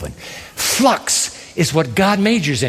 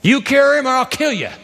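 threatening king; 7 semitones higher than usual. Acted. King's threat paraphrased.

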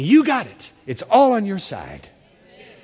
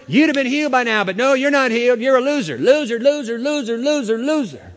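higher, sneering chant of loser; accuser's taunt; 6 semitones higher than usual. Retold or imitated. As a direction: taunt like the devil. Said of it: "That's the way the accuser works".

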